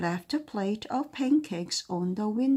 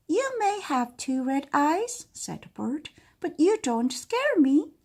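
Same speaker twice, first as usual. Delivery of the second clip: unafraid little bird; 7 semitones higher, 3 dB louder than usual. Acted. Small bird mocks the dragon.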